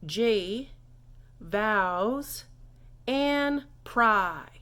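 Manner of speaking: "G, vows, and pry" split with long pauses; word-by-word demo. Shown as a demonstration. Teacher teaching you're welcome, separated word-by-word demo. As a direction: say "G", "vows", "and pry" one at a time. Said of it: "you're gonna say".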